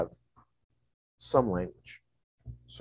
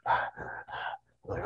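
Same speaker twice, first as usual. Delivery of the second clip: usual speaking voice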